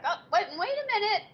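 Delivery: retold high-pitched objection over the dinner vote; high protest. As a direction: squeak out an indignant objection. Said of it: "And I was like".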